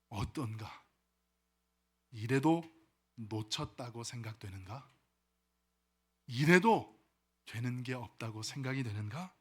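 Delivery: low, measured elder with pauses; old man. Acted. Old man asking the awed youth, low elder voice. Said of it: "옆에 계시던 노인이 청년에게 이렇게 말합니다".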